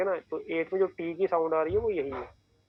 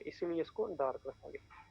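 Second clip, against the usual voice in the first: ordinary talk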